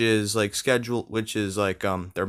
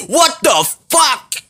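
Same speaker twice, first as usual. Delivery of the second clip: high furious yell, slow; Jericho yelling; 8 dB louder, slower than usual. Retold or imitated. Jericho reacting to the brawl with a loud, high yell. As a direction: bellow it as an outraged Jericho. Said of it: "he was like".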